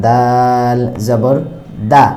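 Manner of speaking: very slow, drawn-out দাল জবর দা; দা drawn out with a thick, heavy a; slow recitation demo. Shown as a demonstration. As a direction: spell it out slowly like a Quran teacher. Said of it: "পরিপূর্ণ আকারের মতো হবে না".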